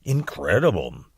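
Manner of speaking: low, dutiful and half-hearted; just going along with her; unenthusiastic echo. Acted. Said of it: "Didn't sound to me like he was quite as enthusiastic".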